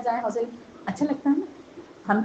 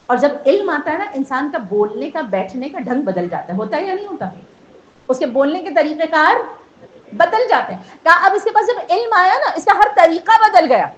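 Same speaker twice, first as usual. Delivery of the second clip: louder, rising sermon climax; preaching at full force; 4 semitones higher, 7 dB louder than usual. Acted. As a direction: drive the point home to the gathering.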